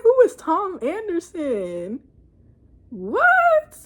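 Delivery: high, drawn-out baffled question; comic high-pitched disbelief; incredulous. Retold or imitated. Baffled reaction to a name.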